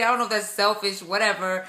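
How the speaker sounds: ordinary talk, nothing marked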